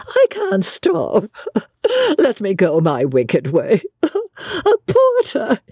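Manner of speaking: high, drawn-out words through laughter; gleeful, squealing hysterics; helpless laughter. A narrator's reading. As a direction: laugh so much you can barely speak. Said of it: "She could not control her mirth".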